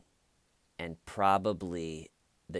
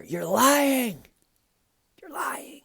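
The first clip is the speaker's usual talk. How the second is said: high screamed "lying", then higher and thinner; screamed accusation; 8 dB louder, slower than usual. Retold or imitated. Viewer yelling at her computer, high retold scream. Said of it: "I actually screamed at my computer".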